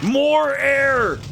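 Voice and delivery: high, drawn-out bossy yell; mock demanding yell. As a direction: demand it like a hammed-up foreman.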